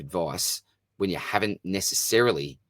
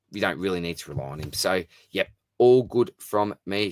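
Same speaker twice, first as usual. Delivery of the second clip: ordinary talk, nothing marked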